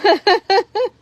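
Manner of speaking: bright, loud burst of laughter; real laugh, high and loud. Real, not acted. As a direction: laugh out loud at a viewer's joke. A viewer's comment makes her laugh.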